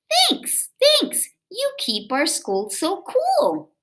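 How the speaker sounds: high, sing-song thankful teacher voice; grateful teacher. Acted. Teacher Miss Banks thanking the boy, high and delighted. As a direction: play the teacher gushing thanks. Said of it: "Miss Banks would say".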